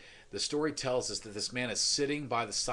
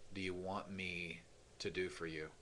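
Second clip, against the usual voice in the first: hushed question; 4 semitones lower, 9 dB quieter than usual. Acted. Jesus asking the listener.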